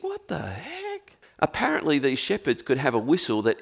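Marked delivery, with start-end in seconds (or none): 0.0-1.2 incredulous. Acted. Incredulous inner reaction in sermon.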